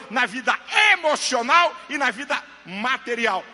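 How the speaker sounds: loud, emphatic pulpit delivery; full-force preaching. Real, not acted. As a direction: preach it out hard to the crowd.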